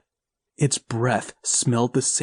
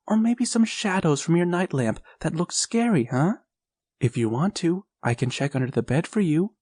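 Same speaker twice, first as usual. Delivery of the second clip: comforting mother; 7 semitones higher than usual. A narrator's reading. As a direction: soothe a scared boy at bedtime. Mother soothing son.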